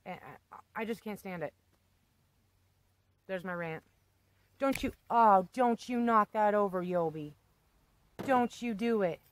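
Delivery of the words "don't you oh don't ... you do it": louder scolding no-no; sharp warning. Real, not acted. Owner scolding her cat Yobi, loud warning. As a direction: stop the cat before it knocks it over.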